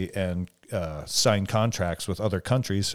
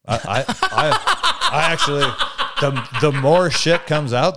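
laughing through the words; breaking up with laughter; 7 semitones higher, 7 dB louder, slower than usual. Real, not acted. Laughing while answering.

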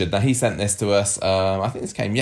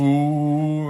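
impressed ooh; 7 semitones higher, 4 dB louder than usual. Real, not acted. Impressed at five out of five.

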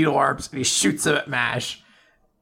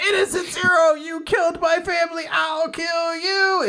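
shouted threat; 13 semitones higher than usual. Retold or imitated. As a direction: scream revenge at the villain. Random person attacking the villain.

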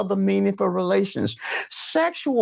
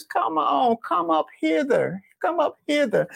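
high-pitched preacher's summons; calling out; 9 semitones higher than usual. Real, not acted. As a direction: call the congregation forward, pitched high. Preacher calls men up front.

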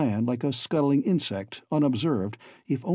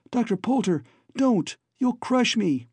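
alarmed cry; 9 semitones higher than usual. A narrator's reading. Babs cries out to Poulter as he tries to seize her, high and alarmed. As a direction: cry out to stop his fingers. Babs dodges his grabbing fingers.

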